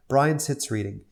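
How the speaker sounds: ordinary talk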